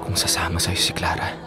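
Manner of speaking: whispered under the breath; jealous whisper about Clara; muttered aside. Acted. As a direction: say it so he barely hears. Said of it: "Ano yun? May sinasabi ka ba".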